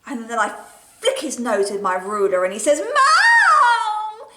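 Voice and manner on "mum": high loud whiny wail; whiny brother. Acted. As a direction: whine for mum like a little brother. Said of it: "in his really whiny brother way".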